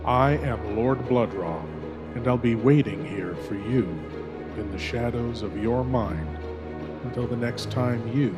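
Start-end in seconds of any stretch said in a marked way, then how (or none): none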